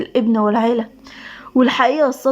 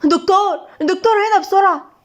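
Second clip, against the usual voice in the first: high, urgent call for a doctor; raised-pitch emergency yell; shouting for help; 7 semitones higher than usual. A narrator's reading. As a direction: cry out in panic for help. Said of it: "وصرخ بعلو صوته".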